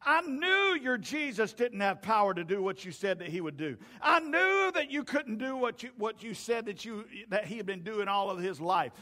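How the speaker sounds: loud, emphatic mock hollering; hollering accusation. Religious leaders hollering at the disciples, loud preached accusation. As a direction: holler it at them, hitting KNEW and NO. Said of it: "they were hollering and screaming at the disciples".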